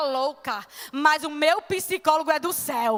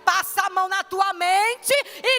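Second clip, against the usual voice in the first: loud, raised preaching with bursts of tongues; fervent preaching; 2 semitones higher, 4 dB louder than usual. Acted. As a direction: preach it out to the congregation. Preaching surge, speaking in tongues.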